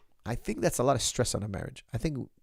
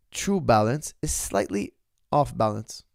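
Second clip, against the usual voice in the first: slow, weighty, each word laid down; slow wise quote; slower than usual. Acted. Host quoting Rumi to his viewers, slow and weighty. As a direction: deliver the saying slowly like a sage.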